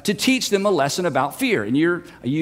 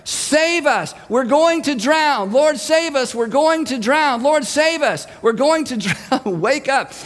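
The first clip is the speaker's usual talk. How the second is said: high, pleading cry for rescue; panicked shouts, pitched well up; acted yelling; 6 semitones higher, slower than usual. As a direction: yell over a storm to wake him. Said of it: "Everyone is yelling. You can't be heard".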